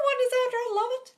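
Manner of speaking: high, bright-pitched delight; light up at the name; squealed high welcome; excited greeting. Real, not acted.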